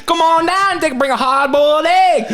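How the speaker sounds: hammy Louisiana call-out; Cajun accent. Retold or imitated. Repeating the Mardi Gras video line, laughing Cajun. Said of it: "Mardi Gras".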